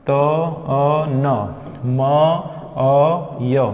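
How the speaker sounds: त अ न म अ य very slowly, one sound at a time; sounding out a name. Shown as a demonstration. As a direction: draw out त, अ, न, म, अ, य separately. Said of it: "इस तरीके से".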